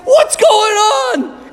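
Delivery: high, slow, stretched-out puzzlement; bewildered. Retold or imitated. Apostles at the empty tomb, high and drawn-out in confusion. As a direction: drag each word out, baffled. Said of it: "they're like".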